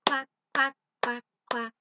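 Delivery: loud sung duck quacks. Acted. Teacher singing mother duck's quacks loud.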